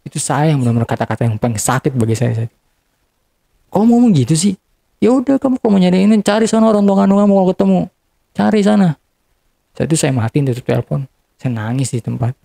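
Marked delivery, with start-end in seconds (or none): 3.7-4.5 high-pitched, affronted comeback; retold indignant protest. Answering back to hurtful words on the phone, indignant protest. 5.0-8.9 higher-pitched, hurried mock scolding; retold angry dismissal. Retelling the hurtful brush-off over the phone, angry dismissal.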